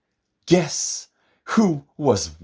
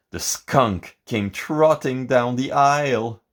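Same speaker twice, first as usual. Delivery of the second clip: plain reading voice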